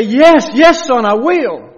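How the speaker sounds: very high, loud, eager answer; eager loud yes. Acted. Preacher voicing the Father longing to answer, eager loud cry.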